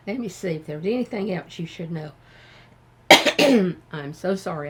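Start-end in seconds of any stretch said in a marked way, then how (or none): 3.1-3.9 loud real throat clear. Real, not acted. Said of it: "I'm coughing".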